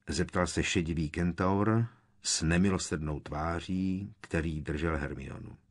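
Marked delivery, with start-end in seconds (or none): none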